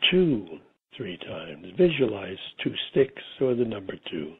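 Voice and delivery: guided meditation. Real, not acted. Guiding listeners into a relaxing state, meditation voice. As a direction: lull them gently, phrase by phrase, leaving space between.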